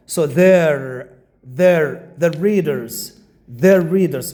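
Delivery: "their" said as one short falling syllable; contracted their. Shown as a demonstration. Teacher repeating the correct short form, weak "their". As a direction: drill "their" and "their readers" with a light "their". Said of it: "but not they are readers".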